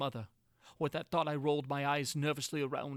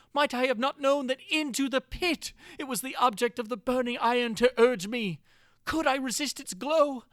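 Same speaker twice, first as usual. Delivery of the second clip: higher and louder, frantic and despairing; desperate shout; 7 semitones higher, 7 dB louder than usual. A narrator's reading. Prisoner raging at himself as the walls close in, frantic shouting. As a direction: rail at yourself in panic. Desperate self-quoted outburst.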